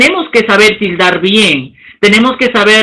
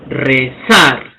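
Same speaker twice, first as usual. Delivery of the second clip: rezar in two low, measured syllables, weight on -zar; stress on last syllable; 9 semitones lower, 3 dB quieter than usual. Shown as a demonstration. Teacher demonstrating agudas to students, rezar stressed on final -zar. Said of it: "La curva asciende".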